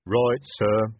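slow, loud troll warning; heavy troll guard, slow and firm. Acted.

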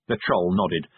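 ordinary delivery, nothing marked

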